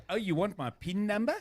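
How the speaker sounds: playful Kiwi phone voice with clipped vowels; mock New Zealand accent. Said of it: "in New Zealand".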